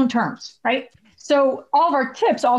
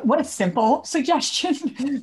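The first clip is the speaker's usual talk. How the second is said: higher, slow, laughing mock disbelief; mock sarcastic; 5 semitones higher, slower than usual. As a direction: tease the advice as too simple, with a laugh. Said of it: "And I'm like".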